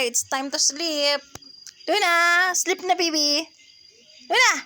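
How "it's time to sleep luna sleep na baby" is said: slow lullaby bedtime voice; slow soothing. Real, not acted. Lulling child to sleep.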